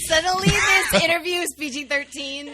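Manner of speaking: loud, high teasing call-out; teasing shout. Real, not acted. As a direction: shout the joke over the laughter. Teasing the host's innuendo.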